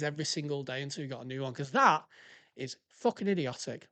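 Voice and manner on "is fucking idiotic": ordinary talk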